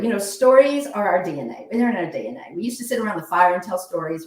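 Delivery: ordinary delivery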